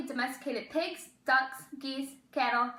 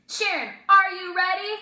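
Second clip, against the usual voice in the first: loud higher announcer call; host calling out; 6 semitones higher, 9 dB louder than usual. Acted. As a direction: hype up the contestant.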